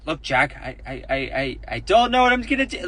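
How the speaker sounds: halting, stammering old-man impression; mock Biden. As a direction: stumble over every I like a lost old man. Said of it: "Imagine if Biden had said that".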